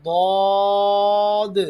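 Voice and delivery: ضاد thick, high and very loud, ending in an audible d; heavy daad. Shown as a demonstration. Teacher models for pupils, heavy long ضاد. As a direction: puff the mouth and sustain ضاد loudly. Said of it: "موٹا پڑھا جائے گا".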